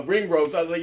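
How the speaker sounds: normal talking voice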